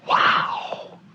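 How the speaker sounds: drawn-out whispered wow; awed whisper. Real, not acted. Preacher marvelling that murderers are made righteous, awed whisper.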